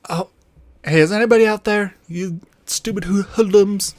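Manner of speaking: high, loud, fast mock dad calling into the dark yard; irritated dad shouting from the porch; grumpy dad voice. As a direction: ham up an annoyed father yelling at hidden kids. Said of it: "her dad comes out and is like".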